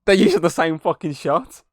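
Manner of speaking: mock indignant shout; imitated shout. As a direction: call it out like a viewer catching a cheat. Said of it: "you shouted".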